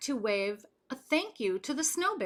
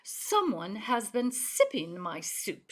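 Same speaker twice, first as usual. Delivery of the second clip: ordinary delivery, nothing marked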